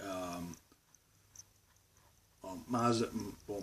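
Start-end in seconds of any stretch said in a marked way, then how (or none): none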